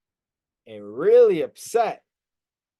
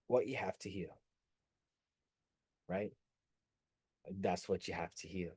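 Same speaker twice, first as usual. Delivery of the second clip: soft low emphasis; 10 semitones lower, 16 dB quieter than usual. Real, not acted.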